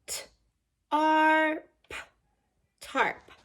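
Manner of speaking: slow letter-by-letter spelling of "tarp"; teacher sounding out tarp; sounding out a word. Shown as a demonstration. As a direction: spell "tarp" as T, R, P, then say it whole. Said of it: "What sounds do you hear?".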